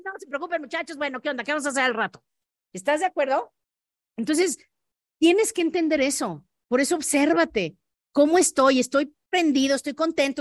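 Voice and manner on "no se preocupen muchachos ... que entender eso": upbeat leader talk. Retold or imitated. Winning leader cheering his team.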